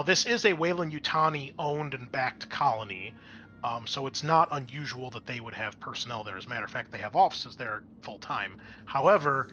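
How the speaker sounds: normal talking voice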